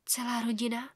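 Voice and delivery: put-on hushed, scared girl's question; frightened whisper. A narrator's reading. Daughter echoes her father's words, whispering scared. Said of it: "pošeptala jsem vystrašeně".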